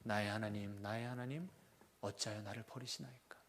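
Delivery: low, soft and hushed, fading out; quiet, low reading of the psalm; quiet lament. Shown as a demonstration. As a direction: read the psalm's opening as a hushed, sinking lament. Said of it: "어떻게 시작하죠?".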